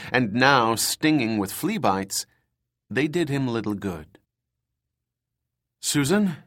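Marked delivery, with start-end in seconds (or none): 5.8-6.4 raised, higher call into the dark; calling out a name, searching. A narrator's reading. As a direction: call her name across the cell block. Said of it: "he called".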